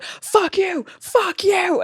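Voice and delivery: a put-on high yell; mock screaming. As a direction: imitate an irate customer screaming. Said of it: "they were, like, scre".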